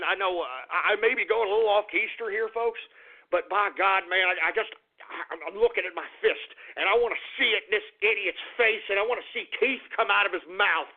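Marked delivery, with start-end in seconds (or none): none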